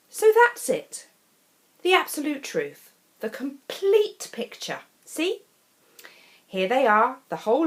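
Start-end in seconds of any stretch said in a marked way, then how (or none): 0.1-5.4 excited reveal. A narrator's reading. Narrator's grand reveal.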